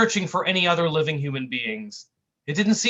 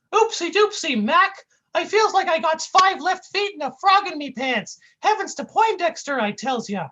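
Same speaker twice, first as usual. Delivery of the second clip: goofy yelp; 6 dB louder than usual. Acted. Sidekick tripping while talking, goofy high yelp. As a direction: stumble into it like a cartoon buffoon.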